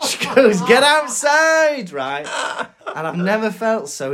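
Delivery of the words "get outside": high, loud, drawn-out retold order; mock shout. Receptionist banishing a covid patient from A and E, high drawn-out mock shout. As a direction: imitate a receptionist yelling someone away.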